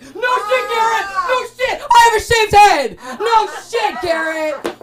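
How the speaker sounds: high, loud mock-outraged yelling; outraged shriek. Shrieking at Garrett in mock outrage. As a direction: shriek it in disbelief at him. Outraged reaction to "look like mother.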